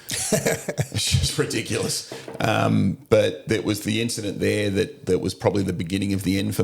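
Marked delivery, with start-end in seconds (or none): 0.1-2.1 laughing. Real, not acted. Laughing at the paperwork.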